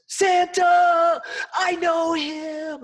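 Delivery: high, loud, slow shout of pure joy; Elf's excitement. Retold or imitated. Elf meeting Santa, shouting with joyful excitement. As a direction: scream it like a thrilled overgrown kid. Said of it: "Elf goes".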